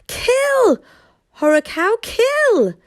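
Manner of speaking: high, loud cry of urging the dragon on; urging shout. A narrator's reading. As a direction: yell the attack order high and loud. Boy urges his dragon to kill.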